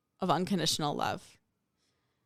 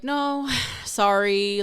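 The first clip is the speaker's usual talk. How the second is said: mock sighing refusal; 3 semitones higher, 4 dB louder, slower than usual. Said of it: "And they were like".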